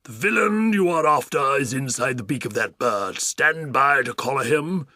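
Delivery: loud commanding, slowing at the end; Duke shouting. Acted. Duke directing the policemen, loud commanding. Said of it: "the Duke was shouting to them".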